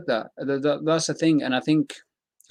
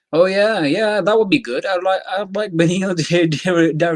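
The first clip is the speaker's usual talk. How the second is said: loud, high, delighted approval; real excitement; 7 semitones higher, 6 dB louder than usual. Real, not acted. Fan reacting to a proposed fight, excitedly. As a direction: burst out higher and louder, laughing midway.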